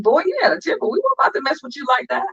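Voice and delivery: high, teasing reply; family teasing. Retold or imitated. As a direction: tease him about his temper. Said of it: "They was like".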